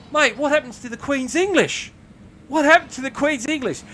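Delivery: high, loud, incredulous protest; appalled. Retold or imitated. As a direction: call out a mate in mock outrage. Appalled challenge to swearing colleague.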